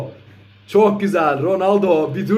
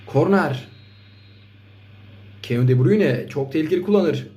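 ordinary delivery

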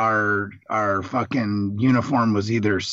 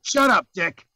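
jokey, higher snap at a friend; higher, louder mock retort to a teasing buddy; 7 semitones higher, 3 dB louder than usual. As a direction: shut him up with a playful jab. Teasing friend who mocked him.

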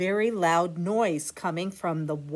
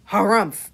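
sniffly harumph; 9 dB louder than usual. Acted. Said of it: "sniffled Walrus".

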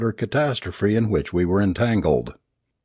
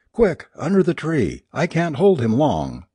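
higher, urgent plea for help; narrated panic, lifted pitch without full screaming; mild shriek; 6 semitones higher than usual. A narrator's reading. Alice struggling to hold the body, mild urgent shriek. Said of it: "she shrieked".

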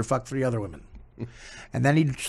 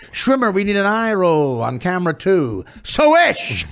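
loud, higher mock cue-calling; TV director; 5 semitones higher, 5 dB louder, slower than usual. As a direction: call out the cue to the studio floor. Acting a TV director calling cues.

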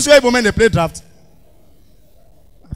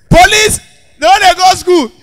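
loud; 8 semitones higher, 5 dB louder than usual. Real, not acted. Comedian's punch line.

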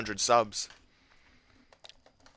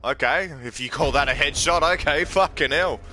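loud, raised-pitch gamer complaint; exasperated protest; 6 semitones higher, 7 dB louder than usual. Real, not acted. Gamer disputing a headshot, exasperated protest. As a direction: protest loudly at the unfair call.

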